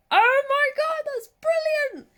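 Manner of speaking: very high, loud, stretched delight; thrilled squeal. Retold or imitated. Retelling her joy at the Zulu scene, squealing high. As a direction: squeal it like a fan who just got it. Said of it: "and I was like".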